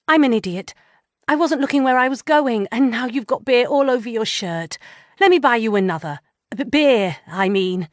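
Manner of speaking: loud, rushed flustered gabble; flustered woman gabbling apologies to a stranger. A narrator's reading. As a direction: blurt it all out, tripping over the words. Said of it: "I'm gabbling".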